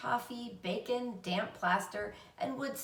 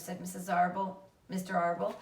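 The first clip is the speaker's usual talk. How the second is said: usual speaking voice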